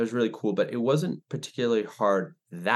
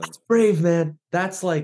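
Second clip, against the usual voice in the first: excited admiration; 9 semitones higher, 6 dB louder, slower than usual. Real, not acted. Moved by a guest's brave story.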